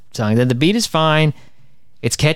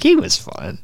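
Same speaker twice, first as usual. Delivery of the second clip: wistful shrug; slower than usual. Retold or imitated. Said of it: "you're like".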